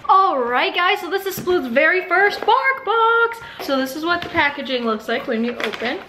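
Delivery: usual speaking voice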